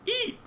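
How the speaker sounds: tiny high-pitched eep; high squeak. Acted. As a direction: squeak like a startled baby creature. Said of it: "you cry".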